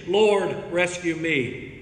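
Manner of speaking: ordinary talk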